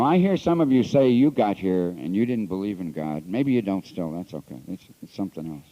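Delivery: ordinary talk, nothing marked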